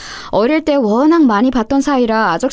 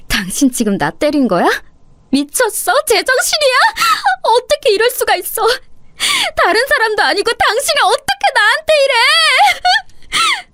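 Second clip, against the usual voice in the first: high, crying voice rising to a sobbing shout; tearful outrage; 14 semitones higher than usual. Acted. Wife sobbing at her husband after being slapped. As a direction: cry out in disbelief at the slap.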